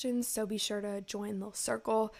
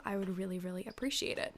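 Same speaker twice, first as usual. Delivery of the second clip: ordinary delivery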